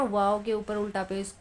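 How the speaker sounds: normal talking voice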